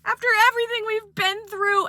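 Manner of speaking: wronged ex confronting her; hammed-up jealous ex; angry ex. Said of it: "She goes".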